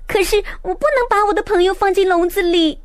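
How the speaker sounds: put-on high protesting boy cry. A narrator's reading. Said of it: "路易斯叫了起来".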